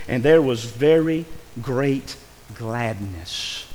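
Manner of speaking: slow, solemn reading of the verse; slow scripture reading. Shown as a demonstration. Preacher reading the verse to the congregation, slow and solemn. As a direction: read it out slow like holy writ. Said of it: "seventeen says".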